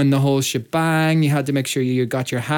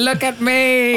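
high, drawn-out kiddie show-off; attention-seeking child; 10 semitones higher, slower than usual. Retold or imitated. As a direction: crow it like a toddler performing. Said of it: "I'm just like".

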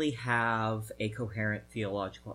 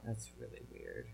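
much softer, lower mutter; muttered aside; 15 dB quieter than usual. Real, not acted. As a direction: mutter it to yourself. Repeats the remark, muttered low.

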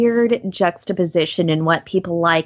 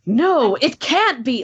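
high, dramatic disbelief; hammy dramatic line; 6 semitones higher, slower than usual. Retold or imitated. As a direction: play it like a B movie. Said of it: "but it's just like".

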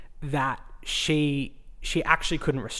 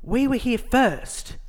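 slow, high, sniffy imitation of the woman; mocking the other dog owner; 7 semitones higher, much slower than usual. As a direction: drag it out like a petty complaint. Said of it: "She goes".

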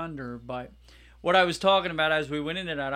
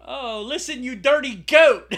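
Gordon Ramsay impression; 7 semitones higher, 10 dB louder than usual. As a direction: bark it like an angry TV chef scolding a cook. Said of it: "I hope they get Gordon Ramsay there".

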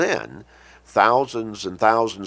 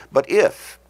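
"if" punched louder and higher; stress on IF; 5 semitones higher, 7 dB louder than usual. Real, not acted. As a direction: hit the word "if" hard. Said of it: "I emphasize that word".

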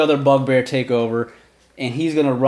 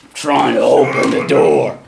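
snapping bugbear; 6 dB louder than usual. Acted. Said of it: "the bugbear's like".

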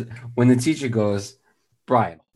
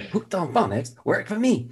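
higher-pitched ad slogan; commercial testimonial imitation; 8 semitones higher than usual. Quoting the phonics commercial, lightly imitated testimonial. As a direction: recite it like the ad kid. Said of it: "that commercial was great".

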